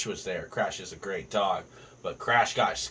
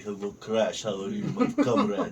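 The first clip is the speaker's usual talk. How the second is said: Russian accent. Shown as a demonstration. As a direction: greet a dog in a put-on Russian accent. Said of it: "do a fucking Russian accent".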